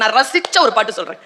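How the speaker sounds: ordinary talk, nothing marked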